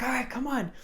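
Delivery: high-pitched rallying call; re-enacted urging. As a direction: egg a friend on, pitched high. Retells urging friend on.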